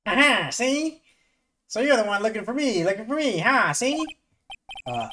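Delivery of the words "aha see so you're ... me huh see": put-on high, loud old-timey gangster lawyer with see; Grossberg voice. Gamer voicing the old lawyer meeting the young attorney, high and loud. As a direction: hammer each see up high like a wiseguy. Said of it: "I don't know how to do a Peter Griffin voice".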